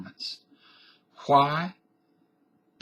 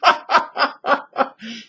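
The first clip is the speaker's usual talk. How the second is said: loud, high burst of laughter; real laughing fit; 12 semitones higher, 4 dB louder than usual. Real, not acted. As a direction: crack up at your own skit. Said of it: "I make myself laugh".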